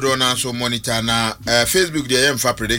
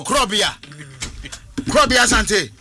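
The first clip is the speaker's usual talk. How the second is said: tag call-out; 8 semitones higher than usual. Pretend. Calling out in mock radio hype.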